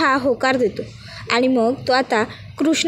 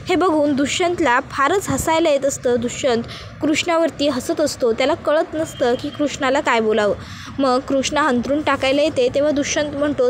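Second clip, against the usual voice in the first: plain reading voice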